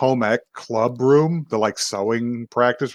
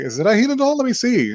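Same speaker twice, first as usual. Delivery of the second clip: much higher-pitched acted curiosity; eager; 11 semitones higher, 3 dB louder, faster than usual. She asks to see his Hina doll, acted eagerness. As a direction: pitch it up like an excited request.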